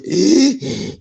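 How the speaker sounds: quick, panicky gasping breaths; hyperventilating gasps. Retold or imitated. Friend gasping in shock beside him, hyperventilating. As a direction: gasp for air like you are in shock. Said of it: "all he can hear now is".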